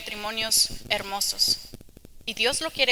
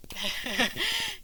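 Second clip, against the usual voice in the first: embarrassed laugh; 5 semitones higher, 5 dB quieter than usual. Real, not acted. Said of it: "se frustra y grita".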